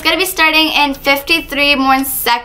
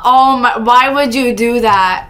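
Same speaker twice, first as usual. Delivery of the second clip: normal talking voice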